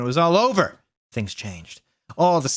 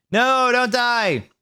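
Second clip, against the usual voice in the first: high, slow desperate plea; pleading; 10 semitones higher, much slower than usual. A narrator's reading. Satan pleading over dying Ebony, high and slow. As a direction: beg her not to die. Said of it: "Satan kneeled down".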